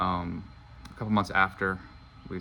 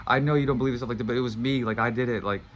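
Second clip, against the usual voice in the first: higher, louder urgent pleading to his wife; passionate plea; 4 semitones higher, 5 dB louder than usual. Retold or imitated. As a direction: pour it all out, begging her to believe him. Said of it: "going off on this thing".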